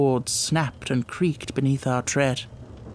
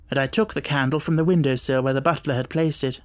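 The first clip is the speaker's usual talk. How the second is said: ordinary delivery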